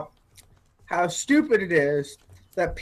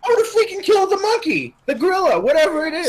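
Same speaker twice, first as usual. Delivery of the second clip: high-pitched, indignant protest; exasperated outburst; 9 semitones higher, faster than usual. Real, not acted. Co-host answering what he would do, exasperated outburst. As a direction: voice shoots up in exasperation.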